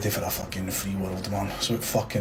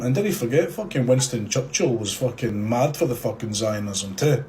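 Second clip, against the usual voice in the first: normal talking voice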